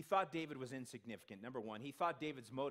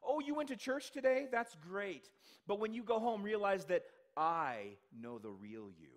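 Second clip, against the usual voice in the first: the enemy's taunt; 4 semitones higher, 7 dB louder than usual. Retold or imitated. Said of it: "That's what the enemy likes to do".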